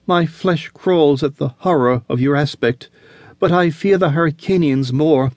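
fearful woman. Acted. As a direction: confess your fear. Olivia frightened.

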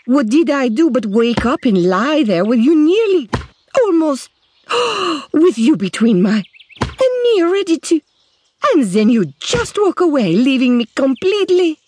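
high, near tears and frustrated; tearful rant. A narrator's reading. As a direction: rant on the verge of crying.

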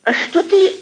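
normal talking voice

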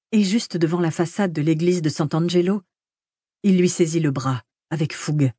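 plain reading voice

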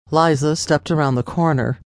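ordinary delivery, nothing marked

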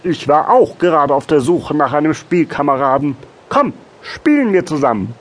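high, loud, eager reply; reply with delight as a second little frog. A narrator's reading. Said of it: "erwiderte der andere erfreut". (erfreute, hohe, laute Froschstimme)

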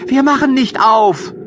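put-on chirpy young kids reassuring mother; little goat kids. (hohe, eifrige Kinderstimme der Geißlein)

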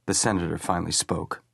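usual speaking voice

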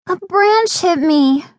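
put-on high, slow child's whine; hurt little girl. A narrator's reading. Little sister explains her hurt nose, high slow whine. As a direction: complain slowly in a small child's voice. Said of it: "Gabby said".

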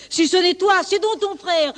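high-pitched, heated accusation; angry wolf in an argument; accusing wolf. Acted. Wolf accuses lamb in acted quarrel.

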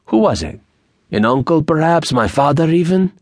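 put-on high, rising string of accented questions; probing Mexican bandit. A narrator's reading. Bandit guesses at who hired O'Brien, probing. Narrator voices Angel guessing.

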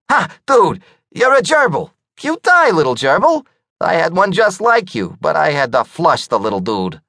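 mock a small gerbil with a cool-guy voice; Skunky's voice.

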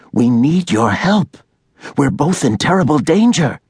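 put-on frightened young woman asking for help; Allie pleading. A narrator's reading. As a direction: beg urgently for help.

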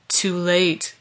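lightly voiced dark demon; a hint of a gloating demon; demonic warrior. A narrator's reading. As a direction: gloat with understated menace. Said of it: "called the demonic warrior".

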